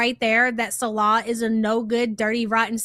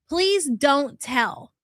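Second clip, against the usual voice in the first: slow quote; much slower than usual. Retold or imitated. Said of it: "listen to the way she says that".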